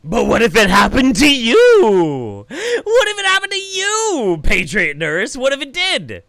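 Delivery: high, loud, slowed outraged yell at the screen; angry shouting. Real, not acted. Streamer yelling at a nurse in a clip, furious. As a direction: yell it back at her, furious.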